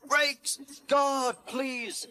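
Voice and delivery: very high little engine voice; high-pitched call. A narrator's reading. Percy asks guard for brakes, high piping call. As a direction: pipe each word up high.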